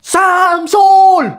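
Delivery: high, loud call across the yard; loud call home. Acted. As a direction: yell for him to come home. Calling him home. (teriakan memanggil)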